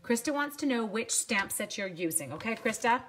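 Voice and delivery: ordinary talk, nothing marked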